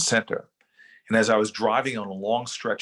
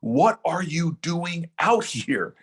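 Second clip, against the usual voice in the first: higher, slow call over the storm; retold yell over the wind; 7 semitones higher, much slower than usual. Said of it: "and I yell at him".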